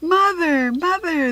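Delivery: high, loud cubs calling; excited cub chorus; roared in chorus. A narrator's reading. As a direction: call out happily to mother. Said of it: "the cubs roared in chorus".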